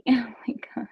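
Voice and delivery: breathy whispered aside. Real, not acted. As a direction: whisper it under your breath. Reacting to chat, whispered.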